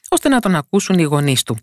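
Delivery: usual speaking voice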